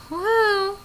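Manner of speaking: normal talking voice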